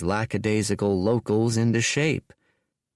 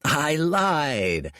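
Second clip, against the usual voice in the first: cackling; 6 semitones higher than usual. Acted. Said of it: "cackled Dorian".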